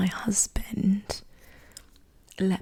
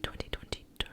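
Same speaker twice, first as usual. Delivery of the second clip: softer whispered retake after an Australian slip; accent retake; 8 dB quieter, faster than usual. Shown as a demonstration. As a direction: repeat it very quietly, minding the Australian accent. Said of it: "See, that was Australian".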